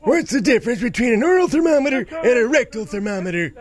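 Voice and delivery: put-on high, creaky old grandpa. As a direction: read it like a doddering grandpa. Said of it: "Maybe say it in a grandpa voice".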